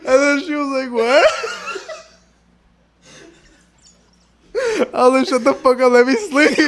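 high, loud, helpless laughing speech; laughing hard. Real, not acted. Telling friends what was said asleep, laughing helplessly. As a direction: barely get the words out for laughing. Cracks up telling his own story.

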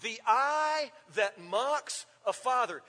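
slow, pressing on eye; slow emphatic reading. Shown as a demonstration. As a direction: hold each word of the verse. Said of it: "Have you ever seen that eye?".

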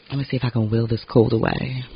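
normal talking voice

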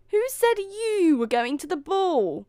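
high, loud, scornful outburst; stepmother's yell. A narrator's reading. Stepmother forbidding Cinderella the ball, yelling. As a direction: yell it in outrage. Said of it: "yelled the stepmother".

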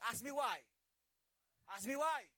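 loud playful ask me why; teasing call. Real, not acted. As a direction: dare the crowd to ask.

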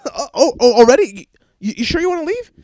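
high, loud fake-polite surprise; to fiancee after long lunch, high loud fake surprise; mock surprise. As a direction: overdo the relieved disbelief. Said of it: "I'm just like".

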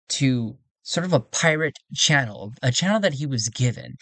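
usual speaking voice

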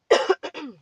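hard loud throat clear. Real, not acted. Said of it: "My voice is all scratchy right now".

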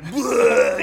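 loud imitated vomiting noise; mock retching. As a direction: heave out a big fake puke. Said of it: "he's throwing up everywhere".

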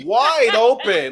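Wide drawn out slow and loud; emphatic wide open. Real, not acted. Telling a friend how empty flights were, emphatic.

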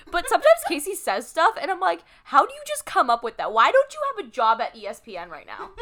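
high, loud gushing; excited praise. Real, not acted. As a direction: rave about her wit.

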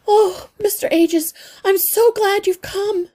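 a small worried mother clinging to hope; anxious mother mouse. A narrator's reading. Mother mouse pleading with the old healer, high and anxious.

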